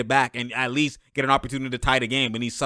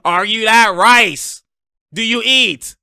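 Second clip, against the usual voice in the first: mocking taunt; 6 semitones higher, 7 dB louder, much slower than usual. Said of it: "Tice is laughing".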